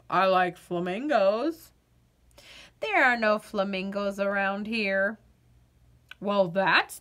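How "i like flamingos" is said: low, glum potato voice; bored potato. Acted. Potato answering the girl, lower and flat-toned. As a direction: sound like a vegetable with no fun left.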